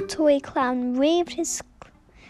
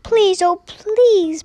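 pleading cry; 5 semitones higher, 7 dB louder, slower than usual. Acted. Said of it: "cried all the dolls and toys".

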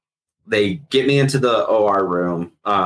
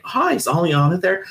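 high, light imitation of a nurse on a call; imitated phone hello, pitched up; retold phone greeting; 10 semitones higher than usual. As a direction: put on a higher-pitched caller's hello. Nurse overheard phoning the wife.

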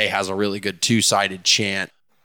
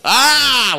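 high, loud "ah" of delight; loud swooning exclamation; excited cry; 14 semitones higher, 6 dB louder than usual. Real, not acted. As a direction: burst out with a thrilled cry. Gushing over favourite chant.

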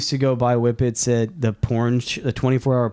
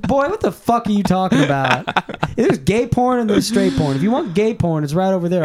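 imitating the clerk; 8 semitones higher than usual. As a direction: set him straight, hammed up. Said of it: "She's like".

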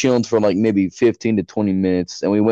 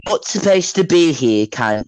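higher-pitched caricature British; mock British accent; 5 semitones higher than usual. Shown as a demonstration. Showing friend how British would sound, mock British accent. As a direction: do an exaggerated British-Australian accent. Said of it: "If he was British".

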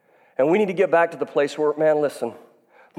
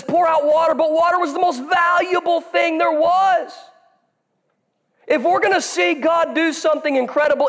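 high, ringing preacher's voice; preaching; 13 semitones higher than usual. Real, not acted. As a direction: preach it up to the rafters. Preaching at full force.

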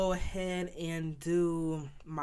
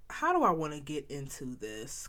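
usual speaking voice

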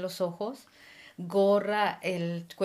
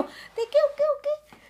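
very high, squeaky question; retelling her own squeaky reply to her husband; 5 dB louder than usual. Retold or imitated.

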